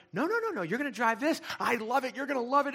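high, loud, gushing excitement; imitating an eager friend, high and thrilled; giddy. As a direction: retell her bubbling over with delight. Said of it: "like, all giddy about it".

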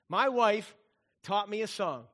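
ordinary talk, nothing marked

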